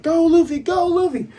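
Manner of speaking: very high cheering yell. Retold or imitated. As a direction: cheer him on as excited friends. Acting out friends cheering.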